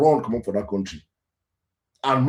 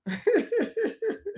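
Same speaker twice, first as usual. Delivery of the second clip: a sharp high-pitched bitter laugh; 5 dB quieter than usual. Real, not acted. Host laughs bitterly at Nigerians' soft-life children, high laugh. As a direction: laugh at how unfunny it is. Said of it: "I'm laughing now".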